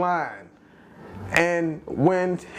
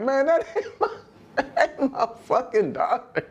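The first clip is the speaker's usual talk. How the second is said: higher, laughing mock outrage; laughing imitation; 9 semitones higher than usual. Friend retelling his disbelief, laughing and higher. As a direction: quote him through laughter. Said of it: "He like".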